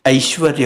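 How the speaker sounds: "ऐश्वर्य" with initial ai glide; correct pronunciation. Shown as a demonstration. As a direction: say "ऐश्वर्य" as a-ishvarya. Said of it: "ऐ जो होता है वो पहले".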